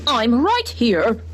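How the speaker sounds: very slow, snorted reply; a calm horse snorts back; snorting horse. A narrator's reading. Horse answers Henry, slow snort. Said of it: "snorted Mister Brown".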